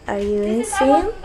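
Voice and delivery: criticism, disagreeing. Shown as a demonstration. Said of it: "for showing criticism or disagree".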